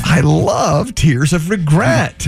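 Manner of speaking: slow, high, drawn-out relish; gleeful relishing. Pretend. Host gloating over a friend's tattoo regret, gleefully relishing. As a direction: savor it with mock rapture. Savoring another person's regret, joking.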